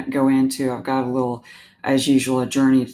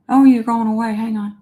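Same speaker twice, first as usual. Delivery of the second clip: startled; 8 semitones higher, 5 dB louder than usual. Real, not acted. Stream connection dropping.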